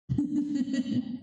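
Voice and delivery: amused chuckle to close the story; light laugh after the punchline. Real, not acted.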